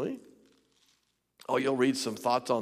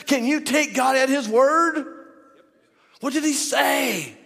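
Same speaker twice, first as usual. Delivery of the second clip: loud, high-pitched, driving sermon; full-force preaching; 13 semitones higher, 8 dB louder than usual. Real, not acted. Preacher challenging the church to take God at his word, full-force preaching. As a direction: preach it at a sustained shout.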